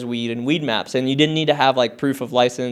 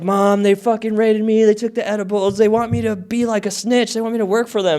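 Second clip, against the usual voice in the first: higher-pitched retold distress; panicked phone call; 7 semitones higher than usual. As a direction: pour out the bad news to mom.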